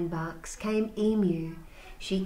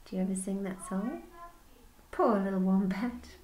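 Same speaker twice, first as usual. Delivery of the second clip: ordinary delivery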